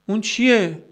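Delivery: emphatic question. Real, not acted. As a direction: ask it high and insistent. Rhetorical question, asked twice. (پرسش تأکیدی با صدای زیر)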